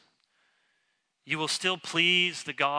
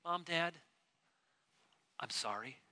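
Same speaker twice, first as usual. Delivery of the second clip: sincere sorry; 4 semitones higher, 6 dB quieter than usual. Shown as a demonstration.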